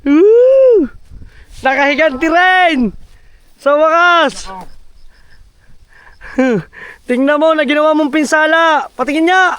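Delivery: high triumphant whoops; excited. Real, not acted. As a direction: cheer the victory high and loud. Triumph after revenge on wasps.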